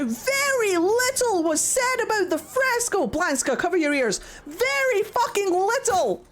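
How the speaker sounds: high-pitched, outraged tirade; real fuming rant. Real, not acted. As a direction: let the frustration push your voice right up. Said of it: "I'm so fucking mad".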